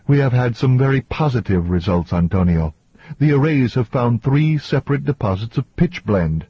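lightly acted woman standing her ground; insistent woman scientist. Voices Victoria defending results.

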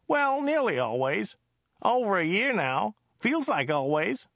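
high-pitched chatty driver voice; high, enthusiastic local driver; Joe the driver, chatty. Acted. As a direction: gush about it in a high character voice.

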